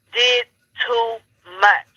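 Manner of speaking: DID, TOO, MUCH spaced out slowly, word by word; slow and weighty, a pause after each of "did too much"; emphatic pauses. Real, not acted. Host summing up what these folks did, emphatic pauses. Drives the point home with pauses.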